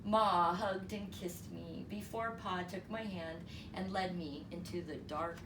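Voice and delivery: plain reading voice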